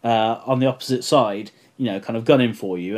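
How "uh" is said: ordinary talk, nothing marked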